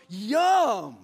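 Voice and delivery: loud, drawn-out cow relish; big hammed-up bovine delight. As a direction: play a cow loudly relishing a mouthful. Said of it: "he goes".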